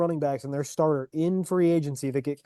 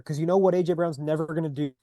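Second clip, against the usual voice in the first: usual speaking voice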